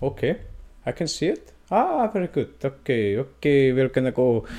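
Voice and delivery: mock Norwegian, checking the mic; Norwegian accent. Host resets, then tries the Norwegian accent again. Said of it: "now I sound Russian".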